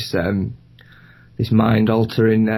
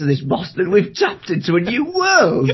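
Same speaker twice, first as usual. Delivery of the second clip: mock visionary; 6 semitones higher than usual. Mock tech pioneer announcing.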